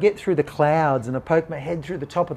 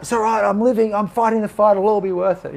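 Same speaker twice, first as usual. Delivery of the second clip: insistent self-reassurance; 7 semitones higher, 5 dB louder than usual. Retold or imitated. Acting his past self-reassurance.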